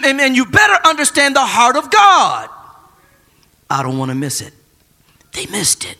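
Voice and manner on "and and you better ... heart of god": high, loud preacher shout; fiery preaching rebuke. Real, not acted. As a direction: hammer it home at full force.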